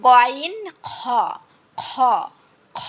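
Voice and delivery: গইন and খ scraped like a cough; cough-like throat sounds. Shown as a demonstration. Teacher demonstrates two throat letters, cough-like গইন, খ. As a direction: rasp খ as if clearing a cough. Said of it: "আমাদের যেমন কাশি লাগে".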